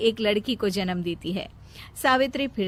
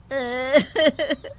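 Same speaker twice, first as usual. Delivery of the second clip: loud mock weeping; acted sobbing; 3 semitones higher, 4 dB louder than usual. Mother breaking down before her sons, sobbing. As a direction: burst into theatrical sobs. Mother weeps, narrator mentions tears.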